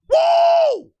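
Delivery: loud, very high "woo"; ecstatic whoop. Real, not acted. Fan hails Bolt fam, ecstatic whoop. Celebrating the draft pick.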